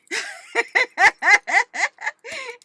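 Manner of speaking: high, loud burst of laughter; helpless loud cackling giggle; giddy laugh. Real, not acted. Sandy trying to calm down, giddy laughter.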